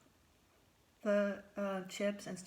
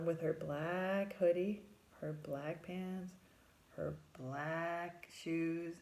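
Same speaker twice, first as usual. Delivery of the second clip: slow emphatic list; slower than usual. Real, not acted. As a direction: slowly list each item.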